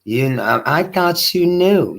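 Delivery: put-on high, soft little-girl voice; mock sweet girlish reply. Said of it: "made her little s-, soft voice".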